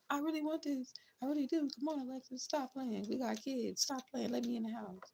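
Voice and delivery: high, soft pleading whine; imitating the ex begging to be let back in; mock begging ex. Mocking the ex pleading with Alexis to come home, high soft whine. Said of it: "try to be all fucking serious. And be like".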